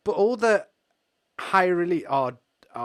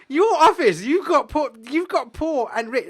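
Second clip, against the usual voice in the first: mocking the Dhaka man; 10 semitones higher, 11 dB louder than usual. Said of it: "He goes".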